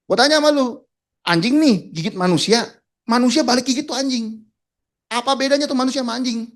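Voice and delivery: loud, high-pitched angry interrogation; angry boss. Retold or imitated. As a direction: imitate a furious boss hammering out a question. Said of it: "Tapi dia marah besar kan".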